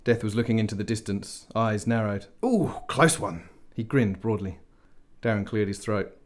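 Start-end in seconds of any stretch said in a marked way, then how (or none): 2.4-3.4 excited. Acted. Death watching battle, excited.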